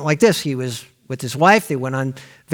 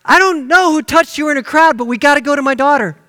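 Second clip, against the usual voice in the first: high, louder, rushed panic; freaking out; 10 semitones higher, 5 dB louder than usual. Retold or imitated. Jairus urging Jesus to hurry to his daughter, frantic. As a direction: hurry him along in a panic. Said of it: "was probably freaking out now".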